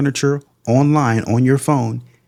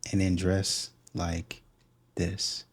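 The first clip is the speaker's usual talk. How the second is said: slow, dropping much softer on "and then dress like this"; fading to a hush; 3 semitones lower, 12 dB quieter, much slower than usual. Shown as a demonstration. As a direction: let "this" sink almost to nothing, slow. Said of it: "talk like that and then dress like this".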